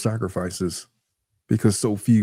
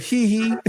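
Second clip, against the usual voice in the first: high mischievous giggle; 10 semitones higher than usual. Retold or imitated. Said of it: "it was like".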